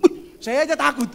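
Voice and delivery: loud, high, laughing exclamation; amused fright. Retold or imitated. Preacher startled by own mirror face, amused fright.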